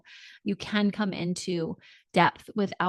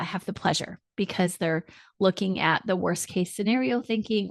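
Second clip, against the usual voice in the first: usual speaking voice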